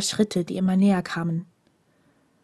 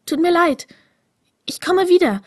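much higher and louder, hurried apology; apologetic young stranger; 9 semitones higher, 6 dB louder than usual. A narrator's reading. Green-eyed stranger apologises to the prisoner, higher and louder. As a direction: promise to come back while rushing off.

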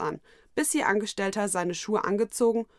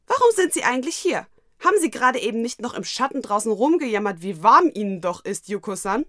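höher und lauter, genervt; empört die Chefin anfahren; gereizt; 4 semitones higher, 7 dB louder than usual. Acted. Gereizter Angestellter stellt Herrin zur Rede. (gereizt und laut)

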